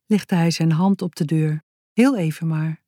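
usual speaking voice

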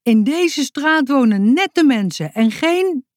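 high, loud, indignant neighbour cut off mid-sentence; shrill woman. Acted. Angry neighbour woman at the door, shrill. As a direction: screech it like an outraged neighbour. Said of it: "met een schelle stem".

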